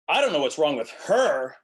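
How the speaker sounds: loud, high line with "her" punched at the end; overdone wrong stress on "her". Shown as a demonstration. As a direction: blare out "her" as a misplaced emphasis. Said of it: "if you're stressing incorrectly".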